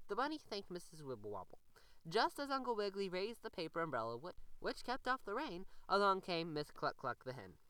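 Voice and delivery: plain reading voice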